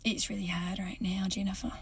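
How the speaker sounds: unvoiced, hushed fake sorrow; mock solemn whisper. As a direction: whisper it to milk sympathy. Faking Australian grief to customer.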